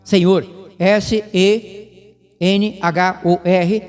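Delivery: slow, letter by letter; dictating S E N H O R one letter at a time; spelling it out. Shown as a demonstration. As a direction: spell Senhor out for the listeners to write down. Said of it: "grafada com as letras em maiúsculo".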